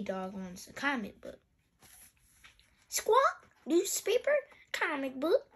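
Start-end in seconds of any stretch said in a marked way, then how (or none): 2.9-5.5 high parrot voice, rising on each item; high squawking bird mimicry; Polly. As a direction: parrot back a list. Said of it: "Polly repeats".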